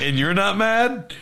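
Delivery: high, slow disbelieving question; retold incredulity. Private questioning a sergeant over frozen pay, acted incredulity.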